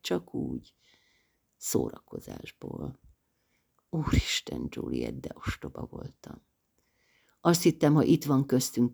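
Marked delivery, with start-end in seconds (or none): 0.0-3.0 low, slow near-whisper; quietly repeating the guards' cruelty, hushed. A narrator's reading. Grim quoted story ends. 3.9-6.4 ashamed whisper, much softer; writing to Sydney in shame, whispered. A narrator's reading. Confessing shame in letter.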